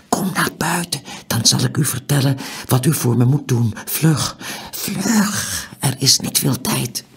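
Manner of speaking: high-pitched, pressing urgency; breathy urgent plea. A narrator's reading. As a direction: hurry him along in secret. Stranger hurries Tiuri outside.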